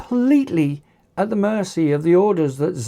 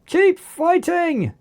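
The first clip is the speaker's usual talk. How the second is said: much higher, slow desperate call; 11 semitones higher, slower than usual. A narrator's reading. Oliver calling to his clones to keep fighting. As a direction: fling the order out in desperation. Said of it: "called out Oliver".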